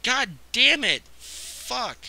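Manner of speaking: loud, drawn-out angry curse; real frustrated yell; frustrated outburst. Real, not acted. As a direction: explode at the screen. Frustrated gamer cursing at screen.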